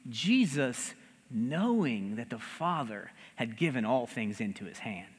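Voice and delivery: hushed reverent rereading of the verse. Shown as a demonstration. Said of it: "the text frames the reality ... so beautifully".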